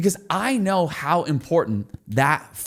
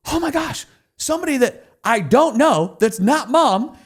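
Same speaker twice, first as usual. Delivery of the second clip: much higher and louder, bursting with excitement; thrilled disbelief; 10 semitones higher, 4 dB louder than usual. Retold or imitated. Founder telling host about his first sale, thrilled and high. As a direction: relive the rush of a stranger buying.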